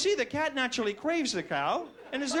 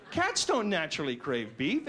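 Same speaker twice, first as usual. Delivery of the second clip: normal talking voice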